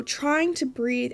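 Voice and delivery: ordinary talk, nothing marked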